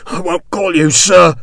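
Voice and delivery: winded and defiant, fighting for air; gasping defiance. Acted. Beaten sergeant gasping his refusal at Sharp. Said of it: "said between gasps for breath".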